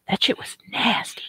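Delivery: hushed disgusted whisper. Real, not acted.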